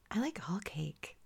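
breathy sultry whisper; sexy voice. Pretend. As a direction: whisper it seductively. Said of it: "but in a sexy voice".

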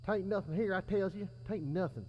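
high, quiet far-off rough voice; distant voice. A narrator's reading. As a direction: heard from far off.

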